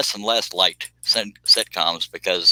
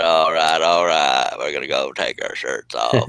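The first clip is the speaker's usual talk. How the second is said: low, growly Texas drawl; lightly voiced McConaughey drawl; Matthew McConaughey impression; 5 semitones lower, slower than usual. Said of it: "Oh, Matthew McConaughey".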